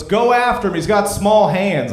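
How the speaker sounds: put-on high, loud kid calling out; imitated playground shout; mock court yell. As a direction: holler it to teammates, slowing at the end. Kid calls out to teammates.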